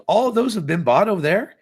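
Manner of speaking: high, loud disbelief; incredulous question. Retold or imitated. Customer questions clerk about sold-out case, incredulous. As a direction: question it as if it cannot be true.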